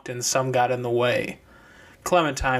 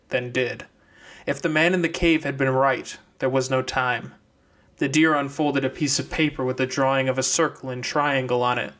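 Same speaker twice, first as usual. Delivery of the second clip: ordinary delivery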